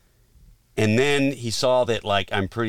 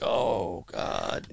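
dismayed groan; 8 dB quieter than usual. Retold or imitated. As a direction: sink into a quiet, retold dread. His dismayed reaction, acted out.

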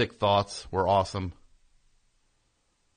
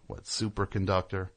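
ordinary talk, nothing marked

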